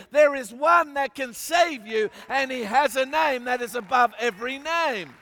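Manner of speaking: ringing declarations with punched key words; impassioned preaching. Real, not acted. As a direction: drive each phrase home to the congregation. Preacher builds to full force.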